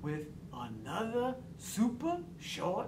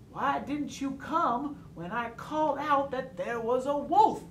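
higher-pitched reproach, louder at the end; angry reproach; 6 semitones higher, 4 dB louder than usual. A narrator's reading. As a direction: demand an answer with mild, hurt anger. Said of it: "he said angrily".

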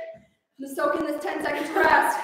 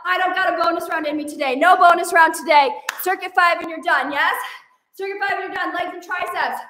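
normal talking voice

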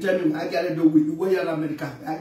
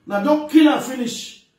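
loud, higher, insistent warning; forceful, raised-voice complaint; emphatic repeat; 6 semitones higher, 6 dB louder than usual. Real, not acted. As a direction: drive the warning home with a raised voice.

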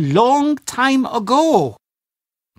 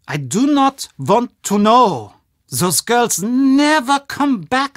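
ordinary talk

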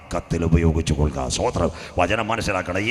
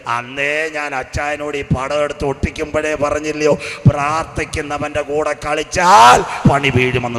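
fiery preaching; 4 semitones higher, 9 dB louder than usual. Acted. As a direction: preach it at full force to the congregation. Preacher builds into full preaching.

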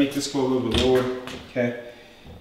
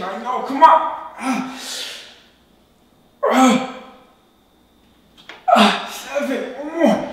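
strained high counting, pushing hard; counting workout reps; 11 semitones higher, 4 dB louder than usual. Real, not acted. Straining through the last reps.

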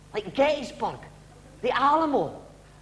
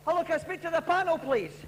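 desperate caller; 7 semitones higher than usual. Acted. Late caller begging to speak.

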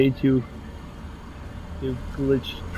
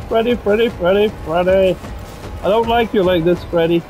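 panicked pleading; 4 semitones higher than usual. Real, not acted. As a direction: beg in fright, then trail off. Panic fading after scare.

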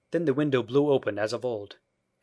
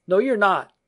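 put-on high, loud childish protest; loud denial; 9 semitones higher, 10 dB louder than usual. A narrator's reading. Peter denies Wendy is married, loud high protest. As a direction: refuse it with a shout. Boy refuses to believe.

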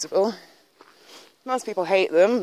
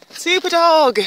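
dog praise; 10 semitones higher, 7 dB louder, slower than usual. Real, not acted.

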